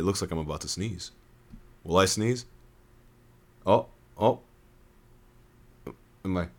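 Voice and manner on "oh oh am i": plain reading voice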